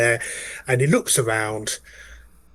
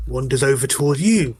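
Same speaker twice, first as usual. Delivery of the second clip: ordinary talk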